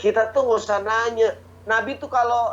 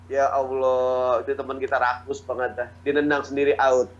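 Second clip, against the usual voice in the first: exasperated sigh; 7 semitones lower than usual. Acted. Frustrated teammate.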